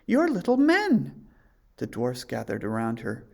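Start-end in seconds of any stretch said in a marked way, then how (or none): 0.1-1.3 much higher, startled realisation. A narrator's reading. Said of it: "she said".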